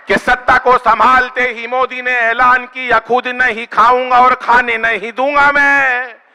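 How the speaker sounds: slow, weighty recitation of the promise; drawn out like a solemn vow; slow declaimed line. Acted. Poet repeats line to audience, slow declaiming.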